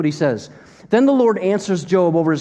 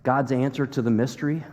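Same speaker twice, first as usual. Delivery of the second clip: ordinary talk, nothing marked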